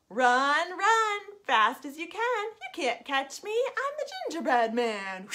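high, cheeky taunting voice; gingerbread man. Acted. Gingerbread man taunting the old couple, high and cheeky. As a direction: tease them from out of reach. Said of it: "he said".

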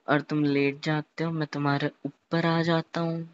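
ordinary talk, nothing marked

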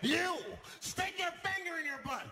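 high shouted command; gunman's barked demand. Retold or imitated. Gunman ordering a passenger, high barked demand.

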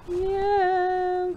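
ordinary delivery

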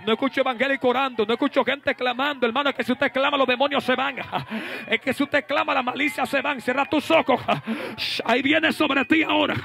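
high, urgent exhortation; full-force preaching. Real, not acted. Preacher demanding that the believers cry out, full-force preaching.

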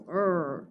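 low, soft mock growl; dog growl. Shown as a demonstration. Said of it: "Dogs growl".

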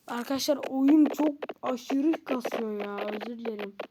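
ordinary talk

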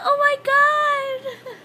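laughing through the words, slow; giggly disbelief, half laughing. Real, not acted. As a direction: crack up mid-phrase. Laughing at friend's remark.

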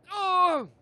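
high, loud "oh" of shock; sudden high yelp; startled yell. Pretend. Player caught by a mishap, loud startled yell.

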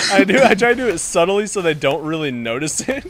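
ordinary talk

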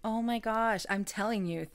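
ordinary delivery

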